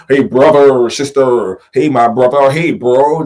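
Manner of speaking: loud, put-on buddy voice calling everyone brother; mocking imitation of outsiders saying hey bro; mocking fake bro talk. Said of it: "you weren't talking like that before".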